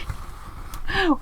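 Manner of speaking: sharp unvoiced gasp of delight; surprised gasp. Real, not acted. Crafter opening a parcel from Susie, delighted gasp. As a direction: gasp as the box opens. Opening box, spots old Chad doodle.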